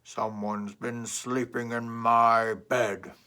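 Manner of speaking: loud, very slow booming papa bear; deep Papa Bear. Acted. Papa Bear finding his bed slept in, loud and slow. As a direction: draw it out, stressing my. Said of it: "and said".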